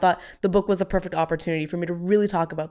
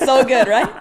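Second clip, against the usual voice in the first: laughing; 5 semitones higher, 6 dB louder than usual. Real, not acted. Laughing over shared joke.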